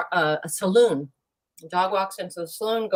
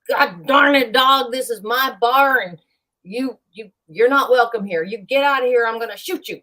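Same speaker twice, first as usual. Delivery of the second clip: louder, higher angry bartender shouting; 6 semitones higher, 5 dB louder than usual. Acted. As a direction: threaten the dog at gunpoint, loud and high.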